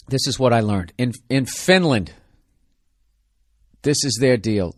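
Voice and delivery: normal talking voice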